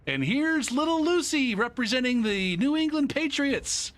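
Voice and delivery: high, slow stadium announcer call. Retold or imitated. Mimicking the stadium announcer introducing each kid, announcer voice. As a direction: boom it out like the PA announcer. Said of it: "they got to".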